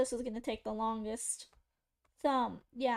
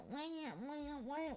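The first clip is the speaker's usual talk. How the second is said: mock baby crying; 2 semitones higher, 9 dB quieter, slower than usual. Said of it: "crying like a baby".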